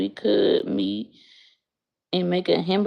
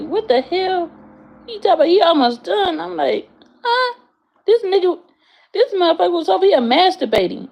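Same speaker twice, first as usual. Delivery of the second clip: pitched way up, fast and incredulous; shocked retelling; 9 semitones higher than usual. Retold or imitated. Telling viewers about a stranger, high shocked disbelief. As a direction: relive the shock, voice jumping high.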